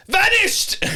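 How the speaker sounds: high, loud dramatic shout of vanished; scream. Retold or imitated. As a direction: shriek it as you disappear. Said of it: "he would scream".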